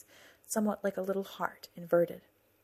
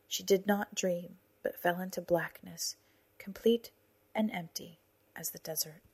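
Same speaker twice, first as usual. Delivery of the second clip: ordinary delivery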